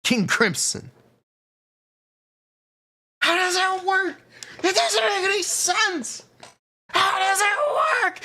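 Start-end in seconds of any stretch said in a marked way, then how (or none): 3.2-8.2 frantic high shouting. Retold or imitated. The classic [name] meme rant.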